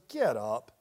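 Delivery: low, no-nonsense order as God; stern divine command. Acted. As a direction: drop deep and order him up. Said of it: "It's not calm reassurance".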